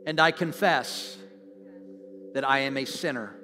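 slow, loud phrases with long pauses to repeat; slow congregational prayer. Real, not acted. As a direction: lead the room in prayer, slowly and strongly. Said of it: "I'm gonna say it slowly".

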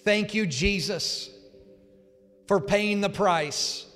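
slow, loud prayer, line by line; loud and strong. Real, not acted. Pastor leads new believers in a prayer, loud and strong. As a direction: proclaim each phrase for the room to echo. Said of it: "loud and strong".

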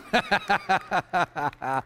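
real chuckle at his own joke; laughing. Real, not acted. Preacher laughs after telling the congregation the punchline, real chuckle.

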